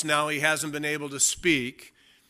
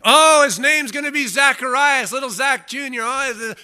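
much higher, loud, sing-song teasing; mocking relatives; 9 semitones higher, 7 dB louder than usual. Relatives cooing their own pick for the baby's name, mocking and high. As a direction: ham up the nosy relatives cooing over the baby.

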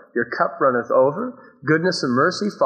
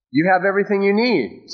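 normal talking voice

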